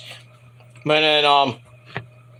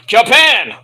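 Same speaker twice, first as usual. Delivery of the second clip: emphatic exclaim; 3 semitones higher, 6 dB louder than usual. Real, not acted. Answering own question loudly.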